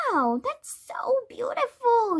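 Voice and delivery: high, breathy-edged admiring wow; impressed little girl, high voice; awed delight. A narrator's reading. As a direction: gush in wonder, pitched well up. Admiring friend's bracelet in story.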